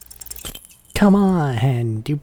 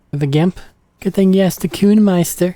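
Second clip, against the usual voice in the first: put-on higher, louder bratty street kid; cocky kid Kuno; 8 semitones higher, 6 dB louder than usual. Streamer voicing a cocky kid who shows off. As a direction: brag like a cocky little punk.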